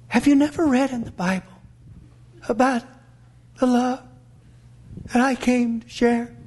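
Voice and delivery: halting, sweet-talking plea with long pauses; mock-gentle Jesus. Shown as a demonstration. Preacher showing how Jesus could have said it, mock-gentle. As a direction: gently coax them, phrase by phrase. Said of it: "could have said it like this".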